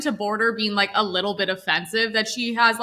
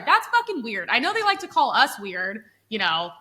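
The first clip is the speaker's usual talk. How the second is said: usual speaking voice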